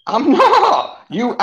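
high, drawn-out squeal of denial; squeaky, laughing denial; mock-indignant protest. Real, not acted. Protesting at piss-taker accusation.

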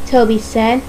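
usual speaking voice

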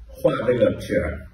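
ordinary delivery

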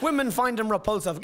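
ordinary delivery

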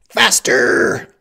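high, explosive shouted order; shouted command. A narrator's reading. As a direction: bark it out at full volume as an order. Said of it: "the Sicilian commanded".

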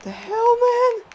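very high, disbelieving cry; incredulous squeal. Real, not acted. Opponent gets back up.

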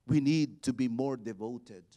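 usual speaking voice

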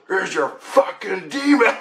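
high, strained mock-hero voice; triumphant demon slayer. Demon slayer showing what he pulled out, hammed-up triumph. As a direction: present the prize with hammed-up triumph. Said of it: "and he goes".

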